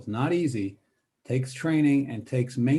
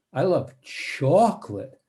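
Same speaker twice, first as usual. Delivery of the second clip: "chocolate" slow, stretched and strongly stressed; savoring "chocolate"; 3 semitones higher, 4 dB louder, slower than usual. Shown as a demonstration. Teacher wants listeners to taste the word, lingering stress on "chocolate". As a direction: say "chocolate" so people can taste it. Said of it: "say chocolate in such a way that people can taste it".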